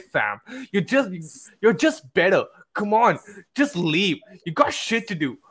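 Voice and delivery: put-on American alpha-male bro, exasperated; American fuckboy accent. Friend reading a poster's relationship rant aloud, mock American fuckboy accent. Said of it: "like, a fuckboy, like, uh, you know, alpha male".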